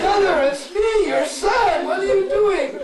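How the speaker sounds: very high, loud, desperate cry; desperate shout. Acted. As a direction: shout up to the roof. Son cries out to mother.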